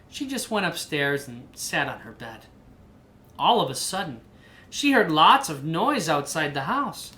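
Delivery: lively picture-book narration; reading to a child. A narrator's reading. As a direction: read the story aloud to your kid.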